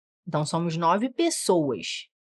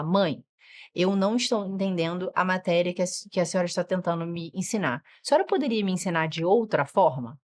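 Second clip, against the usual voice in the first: lower, composed polite request; measured and courteous; speaking calmly; 3 semitones lower than usual. Shown as a demonstration. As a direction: model a child calmly asking mum for help. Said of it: "Eles aprendem a falar com calma".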